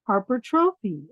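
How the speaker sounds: plain reading voice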